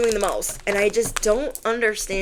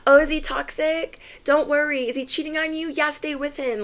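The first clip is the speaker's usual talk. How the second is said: higher, sugary fake reassurance; higher mocking gaslighter; mock fake psychic; 7 semitones higher, 4 dB quieter than usual. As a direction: parody a manipulative reader. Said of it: "gaslight someone".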